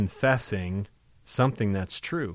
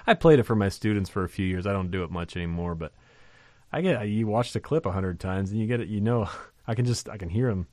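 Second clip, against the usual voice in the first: normal talking voice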